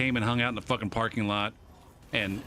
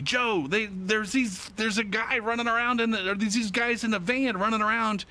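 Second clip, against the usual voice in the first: frantic radio call; 9 semitones higher than usual. Retold or imitated.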